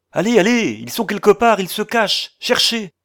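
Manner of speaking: high, loud, frantic urging; urgent shouted orders. A narrator's reading. Man rushing out, ordering companions to search, urgent shout. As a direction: shout back to drive them on. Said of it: "il nous lança par-dessus son épaule".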